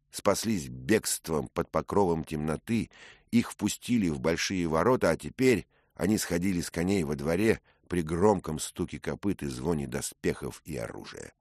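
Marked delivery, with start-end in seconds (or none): none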